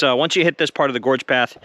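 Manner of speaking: ordinary talk, nothing marked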